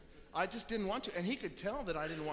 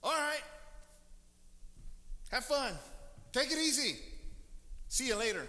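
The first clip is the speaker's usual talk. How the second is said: cheerful send-off; 5 semitones higher than usual. Retold or imitated. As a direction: wave them off happily. Owner cheerfully lets colt go.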